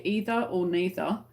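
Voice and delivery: either and neither with a long EE: EE-ther, NEE-ther; British English pronunciation. Shown as a demonstration. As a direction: say "either or neither" the English way, first vowel as in "see". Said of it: "an English way instead of American way".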